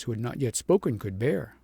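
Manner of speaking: ordinary delivery, nothing marked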